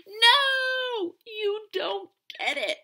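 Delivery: high, loud, near-crying no; wailing protest. Acted. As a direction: protest through tears. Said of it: "said Stink".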